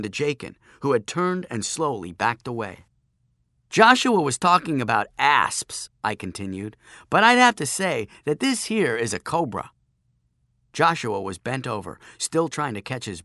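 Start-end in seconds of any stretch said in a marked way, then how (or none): 3.7-5.9 loud, raised conversational bluff; teasing explanation. Acted. Narrator explaining the snake to bullies, loud teasing. 7.1-9.7 raised, louder mock-expert tone; teasing explanation. Narrator showing bullies a cobra, raised teasing voice.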